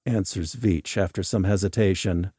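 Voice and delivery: ordinary delivery, nothing marked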